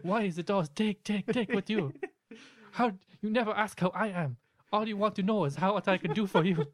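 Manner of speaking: high, far voice, scolding and hurt; Sam impression. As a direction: nag Derek like a fed-up spirit friend. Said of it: "He's like".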